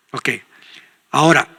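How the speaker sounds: ordinary talk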